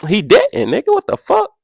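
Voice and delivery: high, incredulous retort; mock outraged squeal of disbelief; indignant disbelief. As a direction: act offended she doubts you. Retorting to the nurse.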